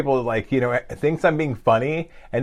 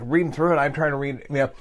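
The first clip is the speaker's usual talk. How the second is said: normal talking voice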